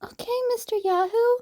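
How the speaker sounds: put-on high, quick child's voice with a sniffle; a small girl trusting through tears; sniffling little girl. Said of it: "Eri sniffled".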